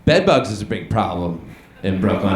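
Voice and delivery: usual speaking voice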